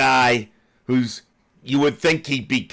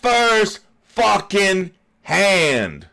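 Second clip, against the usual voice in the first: loud, high, slowed furious rant; venting real rage at a lying official; real anger; 7 semitones higher, 4 dB louder than usual. Real, not acted. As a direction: shout it out, fed up. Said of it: "and I'm getting fucking upset".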